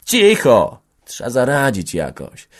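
ordinary delivery

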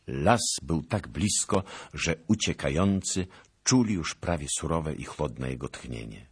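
usual speaking voice

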